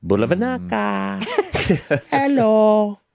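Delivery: high, slow put-on Fijian accent greeting; hammed-up Fijian accent. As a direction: improvise a Fijian accent hello. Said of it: "I'm improvising".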